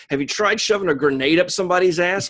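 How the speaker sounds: brash, fast and loud; gung-ho Pulaski. Retold or imitated. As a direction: pitch it like a reckless old broad with a grenade.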